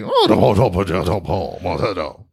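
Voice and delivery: low, unintelligible Southern mumble; slurred gravelly Louisiana muttering, fading out; gravelly Louisiana accent. Shown as a demonstration. Co-host demonstrating the accent, gravelly and hard to understand. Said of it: "It's more of a gravelly".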